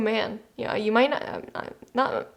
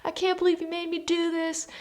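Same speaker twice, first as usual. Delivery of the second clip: high, half-whispered mock protest; resentful kids; 7 semitones higher, 3 dB quieter than usual. Kids complaining to the parent who kicked them out, high mock whine. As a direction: put on high-pitched kids griping at their parent. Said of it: "they're gonna be like".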